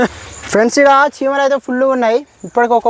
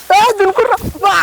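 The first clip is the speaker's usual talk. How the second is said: high-pitched urgent yell to the others; alarmed call; 9 semitones higher than usual. Real, not acted. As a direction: call out sharply high as ants swarm. Ants swarming, calling to others.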